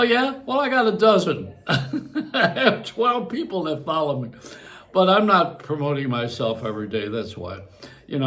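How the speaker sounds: ordinary talk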